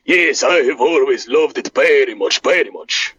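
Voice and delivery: put-on low, earnest Eastern European accent; Boris voice. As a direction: declare your love in the heavy accent. Boris says he loves wrestling.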